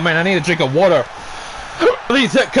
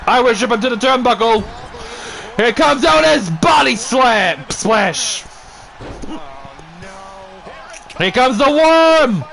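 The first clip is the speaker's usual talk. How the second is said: hyped wrestling commentary, higher and pushing; play-by-play call; 6 semitones higher than usual. Acted. Commentator urging Disco Inferno to fight back, excited play-by-play. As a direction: cheer the wrestlers on like a ringside announcer.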